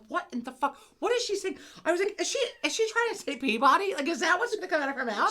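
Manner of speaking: high-pitched incredulous questioning; comic outrage, pitched right up; retold disbelief. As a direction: demand to know, utterly baffled. Retelling her disbelief at the pronunciation.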